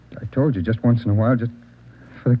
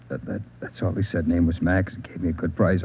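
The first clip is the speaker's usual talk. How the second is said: weak, giving in; 5 semitones lower, 7 dB quieter than usual. Acted. Weak addict gives up name.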